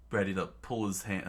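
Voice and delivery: plain reading voice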